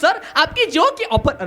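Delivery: high, slow telemarketer lilt; phone saleswoman. Retold or imitated. Company woman phoning a customer, high imitated sales voice. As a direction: imitate a company caller pitching an offer. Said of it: "ती बाई म्हणली".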